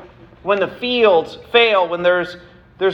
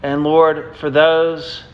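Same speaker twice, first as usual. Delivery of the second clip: lower, earnest prayer voice; closing prayer; 5 semitones lower than usual. Real, not acted. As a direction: pray humbly before the congregation. Pastor leads closing prayer.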